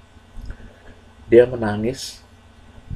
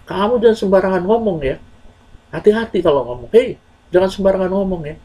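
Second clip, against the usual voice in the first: high, indignant warning; angry retort; 9 semitones higher than usual. Acted. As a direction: snap back at the messenger, offended. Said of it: "anak tersebut pertama marah". (marah dan tersinggung)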